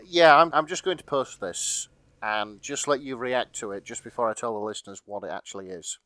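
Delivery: ordinary talk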